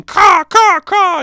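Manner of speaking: loud, high-pitched bird-like screech; screaming creatures. Retold or imitated. Robotic creatures screaming at Moon Knight, loud imitation screech. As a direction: caw it out in piercing bursts. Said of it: "who are screaming".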